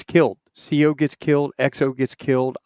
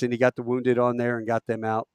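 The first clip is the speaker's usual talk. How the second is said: ordinary delivery, nothing marked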